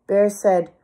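ordinary delivery, nothing marked

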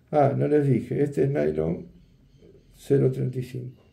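ordinary delivery

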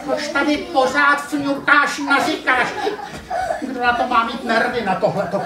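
the cat losing patience with the puppy; exasperated cat; exasperated scolding. Acted.

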